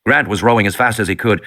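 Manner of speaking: plain reading voice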